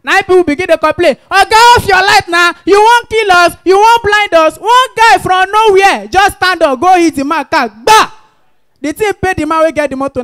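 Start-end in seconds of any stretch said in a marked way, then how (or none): 1.3-4.6 loud, raised-voice protest; acted outcry, hurled at a driver; angry shouted complaint. People complain at a big man's bright headlights, angry shout. 7.7-8.1 loud, sudden vocal thump; imitated bang. Guy hits the rich man's car, imitated bang.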